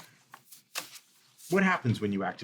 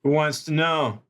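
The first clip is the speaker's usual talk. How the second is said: loud, raised-pitch challenge; calling out challenge; 6 semitones higher, 7 dB louder than usual. Acted. Lookout challenges the visitor from behind the flashlights, calling out. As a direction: call it out sharply to a stranger.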